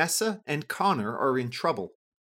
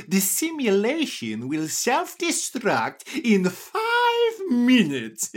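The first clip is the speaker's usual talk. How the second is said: booming, slow countdown announcement; grand riddle-master starts the clock; Sphinx voice; 10 semitones higher, 6 dB louder, slower than usual. A narrator's reading. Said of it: "he says".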